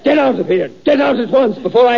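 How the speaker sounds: angry. Acted. Teacher furiously ordering teasing pupil out of class.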